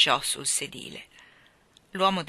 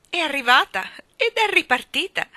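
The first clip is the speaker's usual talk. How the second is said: high, loud, exclaimed reply; emphatic announcement; 9 semitones higher, 9 dB louder than usual. A narrator's reading. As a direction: announce it indignantly, pitch leaping up. Wife answers husband's anxious question. (annuncio enfatico, acuto e forte)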